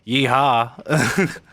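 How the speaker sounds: lightly hammed-up cowboy holler; a hint of cartoon American twang; mock cowboy. Host parodies how Americans supposedly talk, mock cowboy. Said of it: "I'm not over here going".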